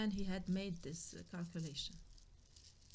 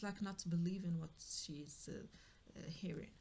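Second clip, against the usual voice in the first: ordinary talk, nothing marked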